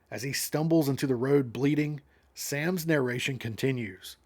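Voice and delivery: ordinary delivery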